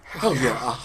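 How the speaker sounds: low, soft repeated murmur; creepy roommate approval. Acted. Roommate reacts to meeting the girlfriend, low soft approval. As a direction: let each one ooze out quietly.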